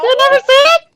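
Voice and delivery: high-pitched laughing repeat; copying high voice. Acted. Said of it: "I was copying it".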